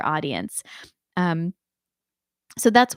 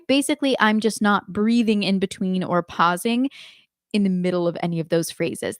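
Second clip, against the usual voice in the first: ordinary talk, nothing marked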